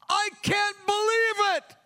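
aghast at himself, pleading; shocked disbelief. Retold or imitated. Young believer to God after sinning, shocked high cry. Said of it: "I was shocked".

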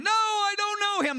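high, anxious cry of denial; panicked denial. Retold or imitated. Peter brushing off his accuser, panicked high denial.

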